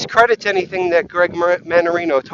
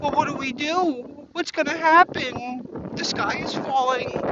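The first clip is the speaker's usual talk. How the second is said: higher, softer fretting whine; a hint of a whimpering host; mock panic; 7 semitones higher, 6 dB quieter than usual. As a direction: fret like a lost puppy. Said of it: "to being".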